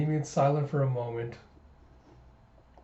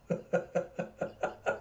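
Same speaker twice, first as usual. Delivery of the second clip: breathy, wheezing laugh; 7 dB quieter than usual. Real, not acted. Storyteller breaks up after George's plea, laughing. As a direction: crack up in quick airy bursts.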